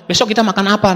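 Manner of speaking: high-pitched mock scolding; wife's angry scolding. Wife repeating her complaint to husband, retold angrily. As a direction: retell a wife's cross question. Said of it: "sampai marah-marah istri saya".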